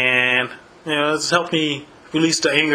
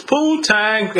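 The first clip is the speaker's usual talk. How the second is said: imitating the game line; 7 semitones higher than usual. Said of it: "it sounds like he says".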